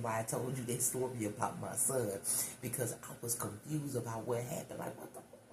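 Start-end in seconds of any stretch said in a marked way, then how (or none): 4.8-5.5 very soft, voiceless whisper; hushed aside of retold shock; whispered confusion. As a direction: whisper it in baffled disbelief. Retells her confusion.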